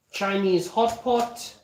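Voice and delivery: normal talking voice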